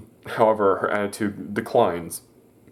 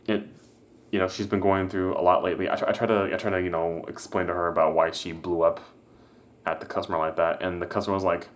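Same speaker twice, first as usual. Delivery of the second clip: normal talking voice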